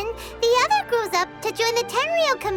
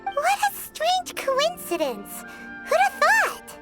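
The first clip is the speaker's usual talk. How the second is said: amazed exclamation; 6 semitones higher than usual. Acted. Companion hearing of the two friends' link, high-pitched amazement. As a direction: marvel aloud in a high little voice.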